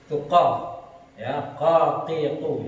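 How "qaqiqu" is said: slow, deliberate "qaqiqu" with a deep q; heavy careful qaf. Shown as a demonstration. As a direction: drag out "qaqiqu" with a heavy throaty q. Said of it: "kalau dibaca qaqiqu". ("qaqiqu" dibaca pelan dengan qaf tebal)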